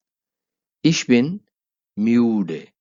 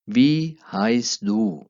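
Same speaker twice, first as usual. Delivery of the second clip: slow, drawn-out German model phrase; slow teaching demo; much slower than usual. Shown as a demonstration. Teacher modelling German slowly for Hindi-speaking learners. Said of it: "pronunciation पर गौर करना है".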